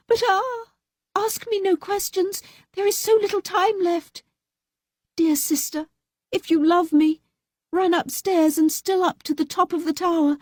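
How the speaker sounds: high pleading woman's voice; urgent, frightened pleading; frightened young wife. A narrator's reading. Fatima begging her sister to climb.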